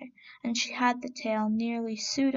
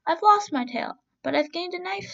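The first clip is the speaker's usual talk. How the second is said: cheerful rabbit; 6 semitones higher than usual. Acted.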